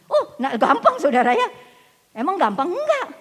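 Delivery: high, loud, laughing challenge; mock-easy then a sharp no; loud teasing preacher. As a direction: tease the congregation with a laugh.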